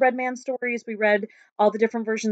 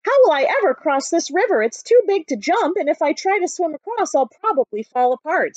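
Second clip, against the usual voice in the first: higher, fretting cheese man at the river; worried cheese man; 5 semitones higher, 5 dB louder than usual. Acted. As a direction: fret about crossing, pitched up. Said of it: "said you-know-who".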